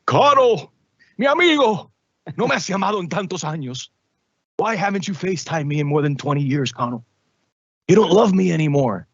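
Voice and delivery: high, overwrought soap opera wail; telenovela drama queen. Retold or imitated. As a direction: ham it up like a novela heroine begging her lost friend. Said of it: "you see the novelas".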